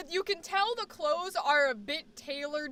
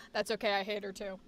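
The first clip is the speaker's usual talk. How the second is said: low, softer aside; back out of character; 6 semitones lower, 5 dB quieter, faster than usual. Acted. To the chat after feminine Rachel, low deadpan. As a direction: drop low and agree. Drops out of the put-on voice.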